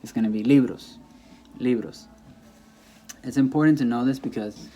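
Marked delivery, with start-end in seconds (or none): 0.4-2.1 "libros" said as LI-bros, falling at the end; stress on LI. Shown as a demonstration. Teacher models the plural for learners, stress on LI in "libros". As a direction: keep the weight on LI in "libros". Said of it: "any word that ends with an S".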